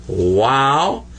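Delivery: ordinary delivery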